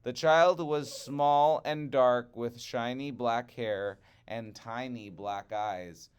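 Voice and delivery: usual speaking voice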